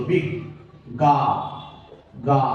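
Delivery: বিগ, গা, গা given as separate slow syllables; sounding out. Shown as a demonstration. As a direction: break বিজ্ঞাপন into বিগ, গা, গা. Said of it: "উচ্চারণটা হবে এরকম".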